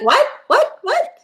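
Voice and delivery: mock panicked disbelief, pitch shooting up; squeaky repeated what. Seth hears Summer likes him, squeaks in mock panic. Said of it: "He's like".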